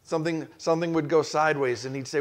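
normal talking voice

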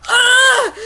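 high, loud hammed-up scream; short shocked shriek; mock scream. Heroine screams at vampires, hammed-up shriek. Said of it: "I screamed".

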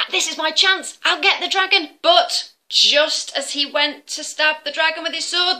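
high, loud eager telling; excited. A narrator's reading. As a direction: rush into the action. Exciting battle narration.

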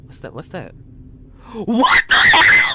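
loud scared yelling; freaked out shout; panicking gamer. Real, not acted. Monster appears, yelling.

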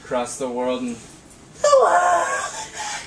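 high, slow, hammed-up crying singing; mock sobbing song. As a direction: wail it like a melodrama.

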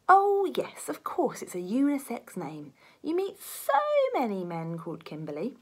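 sarcastic detective sneering at the name. Acted. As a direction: drip with sarcasm. Detective mocking unisex name claim.

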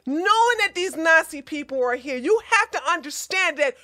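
heated, rising rant; angry condemnation. Real, not acted. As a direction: lay into them, building to the final insult. Scolding viewers who support them.

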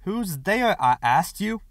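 high, loud, peremptory challenge. A narrator's reading. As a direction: demand an answer at once. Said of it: "the voice said peremptorily".

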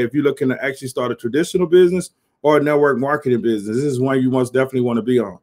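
ordinary talk

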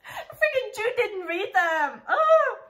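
high, loud, slow fangirl exclaim; excited outburst. Real, not acted. Fan scolding Jude for not reading, excited outburst. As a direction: gush in disbelief.

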